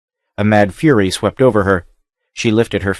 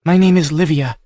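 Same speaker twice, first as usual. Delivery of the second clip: put-on high, earnest captive girl; young woman voice; 8 semitones higher than usual. A narrator's reading. Captive girl answering the barbarian stranger, higher voice. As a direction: speak as the frightened captive Livia.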